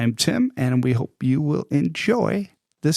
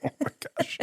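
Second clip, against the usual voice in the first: breathy high exclaim; 8 dB quieter, faster than usual. Real, not acted.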